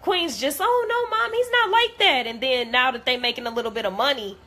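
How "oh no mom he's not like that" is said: high mocking imitation of Queen pleading; high mimicked plea. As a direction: squeak it up like a pleading friend. Mimicking Queen defending Chris.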